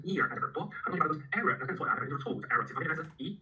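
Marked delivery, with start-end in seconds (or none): 0.0-0.3 "ear" said like the body part; misreading as ear. Shown as a demonstration. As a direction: say "ear" with a rising demo tune. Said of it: "也不要把它读成ear".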